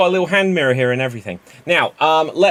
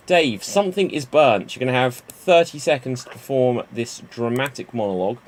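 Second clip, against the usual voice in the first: ordinary talk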